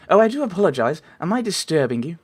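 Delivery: put-on refined ladylike voice; lady's accent. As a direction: act a genteel visiting lady. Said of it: "I keep changing your accent".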